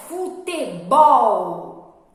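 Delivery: "futebol" in syllables, BOL loud and drawn out; stress on last syllable. Shown as a demonstration. Teacher giving a word to classify, stressed final syllable. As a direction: punch and stretch the end of "futebol". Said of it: "que é a sílaba mais forte".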